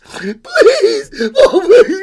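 put-on very loud, high child wail; pleading cry. Girl begs creature during wild climb, loud high wail. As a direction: beg in hammed-up desperation. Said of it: "cried the little girl".

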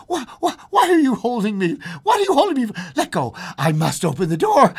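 loud, high-pitched struggling plea; frantic protest. Acted. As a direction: demand it in mounting panic. Woman struggles against husband's hold.

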